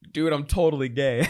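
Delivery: loud, laughing through the words; laughing confession. Retold or imitated. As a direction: blurt it out laughing. Retold coming out, laughing.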